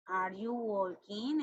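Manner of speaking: slow model question. Shown as a demonstration. Teacher models English question.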